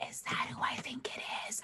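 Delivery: hushed, voiceless whisper. Acted. Player whispering to the guard, hushed. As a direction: whisper it aside to a companion. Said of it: "I'm whispering to the dude".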